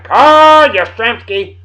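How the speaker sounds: slow, drawn-out announcer call of a star's name; stadium announcer. Acted. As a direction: boom the name out like a ballpark PA. Grand announcement of sports hero.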